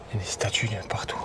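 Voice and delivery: hushed awed whisper; whisper, don't get caught. Real, not acted. Whisper near the guards.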